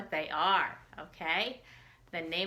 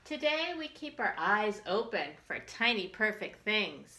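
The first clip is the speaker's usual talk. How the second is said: put-on reading to children; 3 semitones higher than usual. Teacher reads picture book to children.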